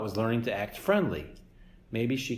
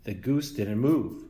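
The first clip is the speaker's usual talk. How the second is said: ordinary delivery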